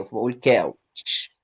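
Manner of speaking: كاوتش drawn out slowly with a long aa; كاوتش said slow with open aa; slow model. Shown as a demonstration. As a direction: stretch كاوتش slowly as kaawtsh. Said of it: "الأو يو بتعمل آ".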